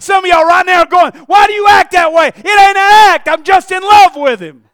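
high, shouted sermon fervour; full-force preaching. Acted. Preacher answering those who question his worship, full-force preaching. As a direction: shout it out with everything you have.

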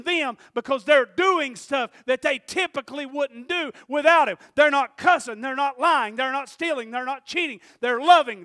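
loud, high preaching cry, slowing at the peaks; full-force preaching. Acted. Pastor crying out the Spirit's effects, loud preaching. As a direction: shout the sermon's climax to the room.

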